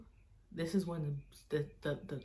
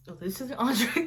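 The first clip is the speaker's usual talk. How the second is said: high, louder imitated server; nice waitress; 6 semitones higher, 7 dB louder than usual. Waitress explaining the menu, nice, high and louder. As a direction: mimic a polite waitress, pitched up and projecting. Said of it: "she was being so nice".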